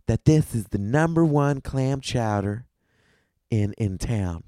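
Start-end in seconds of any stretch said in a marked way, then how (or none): none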